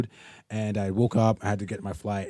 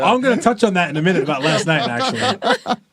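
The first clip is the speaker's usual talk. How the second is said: laughing; 6 semitones higher, 6 dB louder than usual. Real, not acted. Laughing at the banter.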